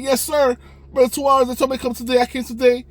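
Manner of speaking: high mock-outraged teen; high indignant insisting. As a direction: dig in like a wronged kid. Answering the manager.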